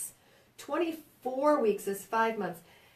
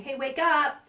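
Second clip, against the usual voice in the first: mock alarm; 5 semitones higher, 7 dB louder, slower than usual. Body voiced calling out.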